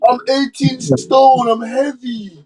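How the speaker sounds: high, slow whiny mock of a sulking boxer; mocking AJ's excuses. Podcaster mimicking the boxer's excuses, high and whiny. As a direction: whine it like a spoiled brat making excuses. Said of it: "his stupid excuses".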